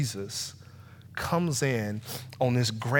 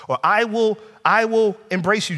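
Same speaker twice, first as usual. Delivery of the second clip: raised, higher voice making a loud promise; loud bargaining plea; 9 semitones higher, 7 dB louder than usual. Acted. Preacher voicing people who bargain with Jesus, loud. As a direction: belt the bargain out high and loud.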